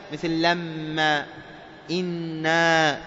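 لما and إنا slowed, doubled consonant held with ghunna; slow demo of ghunna on لما and إنا; nasal ghunna shown. Shown as a demonstration. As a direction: hold the ghunna on لما and إنا for two counts. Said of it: "يجب إظهار الغنة".

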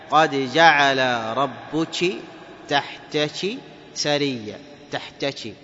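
slow recital, "ربك" and "تحتك" ending in chi; kaf as ch. Shown as a demonstration. Teacher shows variant reading, slow chi ending on "تحتك". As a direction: draw out the verse, k becoming ch in "تحتك". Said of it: "الكاف شين".

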